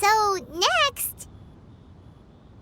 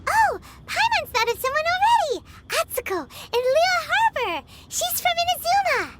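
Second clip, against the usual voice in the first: excited; 6 semitones higher than usual. Acted. Excited realization.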